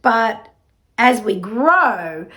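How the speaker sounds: ordinary talk, nothing marked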